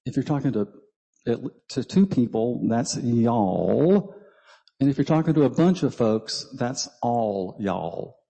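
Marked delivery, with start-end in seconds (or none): 3.0-4.1 Y'ALL as one drawn-out syllable; demonstrating y'all. Shown as a demonstration. Speaker teaching congregation to conjugate y'all, Y'ALL demonstrated and held long. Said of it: "So I'm gonna speak it". 7.0-8.2 slow, drawn-out ALL Y'ALL; demonstrating all y'all. Shown as a demonstration. Teaching the form for a bunch of folks, ALL Y'ALL said slowly. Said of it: "So I'm gonna speak it".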